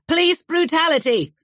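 loud shouted protest; yelled. Acted. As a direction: holler it for the street to hear. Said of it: "He threw his head back and yelled".

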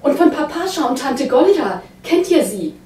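high, loud excited cry to her dead kin; a wife crying out for news of her dead family. A narrator's reading. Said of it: "schrie die Frau".

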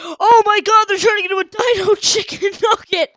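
loud, high-pitched horrified outburst; real shocked yell. Real, not acted. Player watching animatronics turn into dino nuggets, shocked yell. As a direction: cry out in disbelief at the top of your voice.

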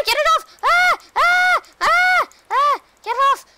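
screaming in terror; shrieking, panicked at a spider; phobic panic. Real, not acted. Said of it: "I'm terrified of spiders".

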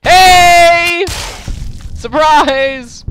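very high, loud ambush yell; taunting shout. Real, not acted. Player springing an ambush on an enemy, loud taunting shout. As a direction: leap out and scream it at him.

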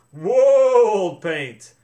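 loud call of whoa; loud rider's call to the horse. A narrator's reading. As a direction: rein the horse in with a loud cry. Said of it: "cried Austin".

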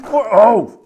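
ordinary talk

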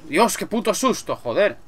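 sudden frightened shout; startled yelp. Real, not acted. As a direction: jump out of your skin and yell. Jump scare in horror game.

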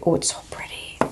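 breathy little whisper of delight. Real, not acted. Admiring the pretty blush up close, whispered. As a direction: whisper it as if to yourself.

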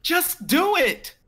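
panicked high shout. Acted. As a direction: yell it in a panic. Panicked urging.

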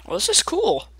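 ordinary delivery

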